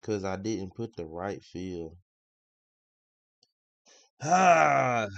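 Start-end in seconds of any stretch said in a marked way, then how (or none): 4.2-5.1 loud, high exasperated sigh; frustrated groan. Real, not acted. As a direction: let out a big frustrated "ah". Venting over the missed entry.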